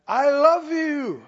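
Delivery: slow, loud booming I love you; mock yell from heaven. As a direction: bellow it down from the sky, slowly. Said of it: "He yelled from heaven".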